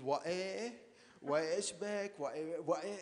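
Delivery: lightly imitated Beiruti accent; a hint of an old aunt's Beiruti Arabic; heavy Beiruti accent. Recalling a great-aunt's catchphrase for listeners, lightly mimicked Beiruti accent. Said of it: "she spoke in this very heavy Beiruti accent".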